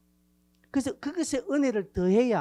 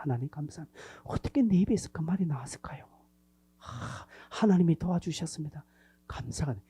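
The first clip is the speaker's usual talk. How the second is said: hushed, grateful whisper to God; whispered prayer; 3 semitones lower, 4 dB quieter than usual. Acted. Believer whispering thanks to God after the talk, hushed. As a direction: breathe the thanks to God softly.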